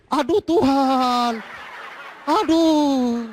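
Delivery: slow, high exasperated groan; drawn-out despairing sigh of words. Retold or imitated.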